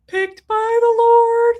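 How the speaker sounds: high, loud, slow and grand, like a royal herald; mock-regal proclamation. Owner hams up the cat's heavenly status, grand and high.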